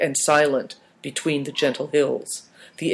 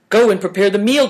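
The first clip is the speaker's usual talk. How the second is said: higher, louder and fast call; cried out; 6 semitones higher, 4 dB louder, faster than usual. A narrator's reading. Uncle calling his nephew to go cook, loud and fast. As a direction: hurry the boy off with a shout. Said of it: "cried Uncle Chen".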